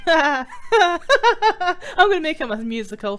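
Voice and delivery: talking through laughter; laughing. Real, not acted.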